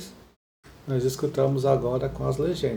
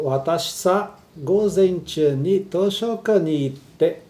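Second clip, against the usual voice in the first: slow reading; 2 semitones higher, 4 dB louder, slower than usual. Shown as a demonstration. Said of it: "Tá escrito".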